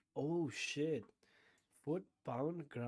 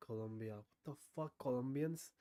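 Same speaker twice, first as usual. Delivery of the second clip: ordinary talk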